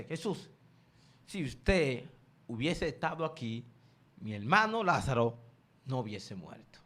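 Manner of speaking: low, quiet grieving plea to Jesus; a sister choking back tears, pleading softly; tearful plea. Acted. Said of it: "se tira y comienza a llorar". (súplica llorosa, grave y baja)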